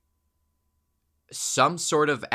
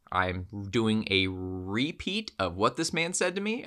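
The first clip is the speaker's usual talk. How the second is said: ordinary talk, nothing marked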